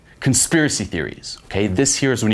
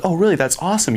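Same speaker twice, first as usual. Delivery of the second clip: higher-pitched, impressed reaction; acted delight; 10 semitones higher, faster than usual. Girl hears he's from near Vancouver, acted delight. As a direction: imitate a girl thrilled by the coincidence. Voicing the girl's delighted reply.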